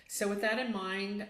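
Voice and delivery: ordinary talk, nothing marked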